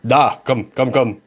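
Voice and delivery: put-on brisk summons in a Slavic accent; Slavic-accented call from behind the counter; Slavic-accented contractor. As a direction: wave them over with a thick Slavic accent. And you hear.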